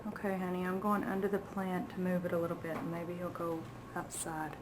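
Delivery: softly, quickly under breath; hushed. Real, not acted. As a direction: keep quiet so bird stays calm. Quiet to partner near bird.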